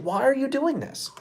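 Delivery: usual speaking voice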